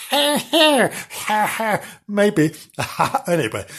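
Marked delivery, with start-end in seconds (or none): 0.1-1.8 high mewing ha ha; cat laugh attempt. Shown as a demonstration. Narrator tries a cat's laugh, high mewing. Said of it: "how can he do a ha ha".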